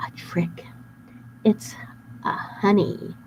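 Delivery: unvoiced hush; whispered. A narrator's reading. Narrator sharing the plan, whispered. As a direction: whisper it like a secret.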